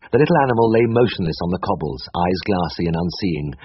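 plain reading voice

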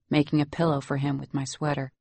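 usual speaking voice

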